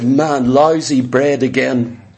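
low-pitched mock moan; mimicked grumbling, dropped low in pitch; grumbling complaint. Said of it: "They moaned, they grumbled, they complained".

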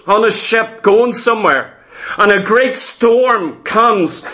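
usual speaking voice

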